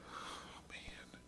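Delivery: breathy, voiceless hush on the words; whispered exclamation. A narrator's reading. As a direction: drop to a bare whisper. Whispered line from the text.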